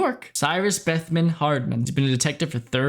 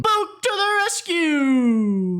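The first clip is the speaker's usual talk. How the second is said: much higher, slow hero-arrives call; heroic announcement; 10 semitones higher, much slower than usual. Acted. Recapping Book's rescue as a slow, high hero cheer. Hyping a character's entrance.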